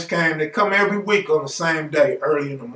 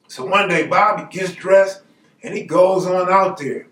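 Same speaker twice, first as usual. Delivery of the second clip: usual speaking voice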